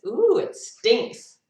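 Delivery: high, slow, loud mock disgust; other monkey's reaction. Other monkey reacting to a smell, exaggerated complaint. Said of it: "And the other monkey says".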